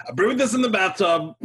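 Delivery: half-shouted brag from a cheerful moonshiner; bartender voice. Acted. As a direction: barkeep proudly showing off his homebrew. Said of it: "He goes".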